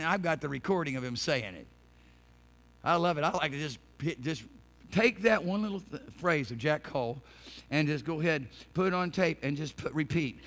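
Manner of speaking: ordinary delivery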